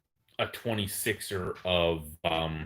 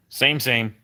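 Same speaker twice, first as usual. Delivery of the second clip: talking to a dog; 6 semitones higher, 4 dB louder, slower than usual. Real, not acted. Cooing at the dog.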